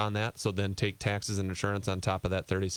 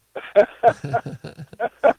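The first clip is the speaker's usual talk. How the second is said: loud laugh; 11 semitones higher, 11 dB louder than usual. Real, not acted. Guest laughs grabbing calculator.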